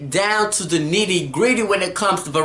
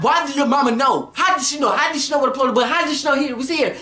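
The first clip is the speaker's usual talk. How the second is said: heated questioning; 7 semitones higher, faster than usual. Retold or imitated. Acted grilling over who told.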